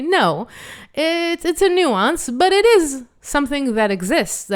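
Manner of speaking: ordinary talk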